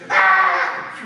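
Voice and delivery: a sudden loud startled yell; alarmed scream. Shown as a demonstration. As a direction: cry out as if the fire is at the door. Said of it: "like that".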